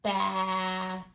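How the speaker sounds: Bath drawn out slowly. Shown as a demonstration. Teacher slowly sounds out Bath for children. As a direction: stretch Bath so each sound is heard. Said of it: "Say it very slowly".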